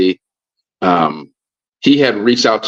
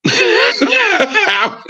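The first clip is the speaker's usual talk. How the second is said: high, laughing repeat of the question; amused squeal through laughter. Real, not acted. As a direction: crack up while saying it. Laughs while repeating his retold question.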